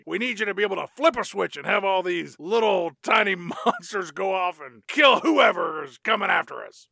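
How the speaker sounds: gruff general, rising to a shout; rally the troops, building loud; military general voice. Acted. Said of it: "It's my military general voice".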